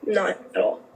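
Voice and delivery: mild mock sobbing; tearful reply. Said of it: "I break down sobbing".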